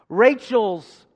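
loud, strong answer. Shown as a demonstration. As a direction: call the name out boldly. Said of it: "Say it loud and strong".

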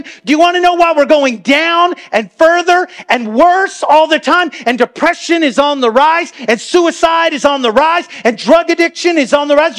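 full-force preaching. Real, not acted. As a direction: preach it out to the room.

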